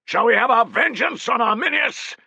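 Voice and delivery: high, loud, fierce call; rallying cry. Acted. Centurion firing up his men for revenge, high and loud. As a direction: whip the men into fury.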